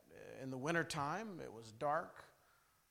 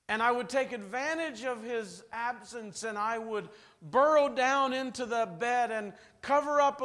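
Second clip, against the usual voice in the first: impassioned preaching; 6 semitones higher, 8 dB louder than usual. Acted. Preaching story fervently.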